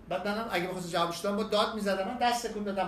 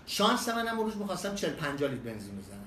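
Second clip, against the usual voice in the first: ordinary talk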